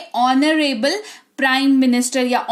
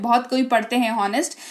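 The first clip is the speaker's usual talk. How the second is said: ordinary delivery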